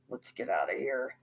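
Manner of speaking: low, soft dismayed mutter; low, soft mutter to himself. A narrator's reading. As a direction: mumble it under your breath. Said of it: "He muttered".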